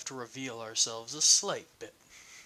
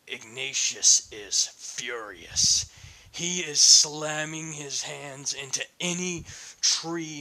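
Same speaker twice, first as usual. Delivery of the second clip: slow, heavy storyteller voice; intense narration; 6 dB louder, slower than usual. A narrator's reading. GM narrates Ignatius's rampage, slow and heavy. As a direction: lean into the rage, slowly.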